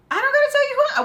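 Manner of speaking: very high, loud retort; acted yell. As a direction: shriek it back mid-fight. Acts own yelling back in fight.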